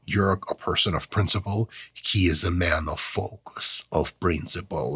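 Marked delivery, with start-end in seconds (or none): 1.9-4.9 slow, low, badly faked Russian accent; fake Russian accent. Quoting a John Wick line to the video guy, fake Russian accent. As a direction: put on a terrible Russian accent, quieter and drawn out. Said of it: "terrible fake Russian accent by non-native speaker".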